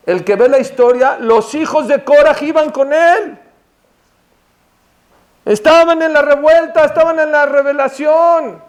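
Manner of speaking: high, emphatic sermon delivery, building; impassioned preaching. Acted. Preacher retelling Korach's revolt to the congregation, rising fervor.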